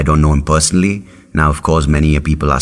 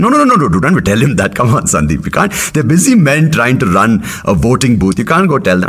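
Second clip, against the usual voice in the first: panicky mum; 7 semitones higher than usual. Retold or imitated. Mum urgently stopping Sandeep from reporting the machine. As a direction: hush him frantically like a worried mother. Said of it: "mum's reply".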